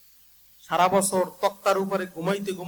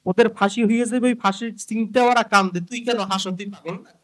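scolding king; 3 semitones higher, 3 dB louder than usual. Acted. King berating a laughing thief, acted loud scolding. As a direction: burst out at the fool, then ease off at the end. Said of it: "ধমক দিয়ে বলে".

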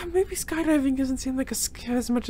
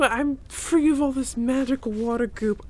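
normal talking voice

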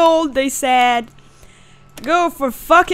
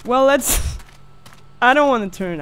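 ordinary delivery

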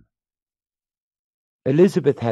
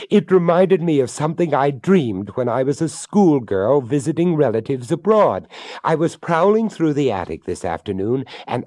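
high, excited and rushing; eager; 7 semitones higher, 4 dB louder than usual. Acted. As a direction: bubble over with excitement.

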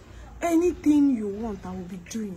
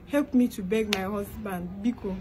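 normal talking voice

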